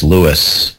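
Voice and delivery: "Lewis" with the final s sounded; pronounced Lewis. Shown as a demonstration. Correcting co-host on the singer's name, "[name]" with final s. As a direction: say "Lewis", ending on an audible s. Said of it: "I don't think you know him well enough".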